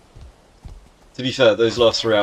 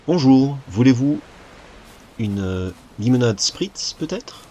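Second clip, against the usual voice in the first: a louder, bolder French accent on "Bonjour. Voulez-vous"; hammed-up French accent; 2 semitones higher, 4 dB louder than usual. Shown as a demonstration. Streamer retrying his French for chat, a hammed-up French accent. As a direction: commit fully to the French accent this time. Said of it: "That accent there".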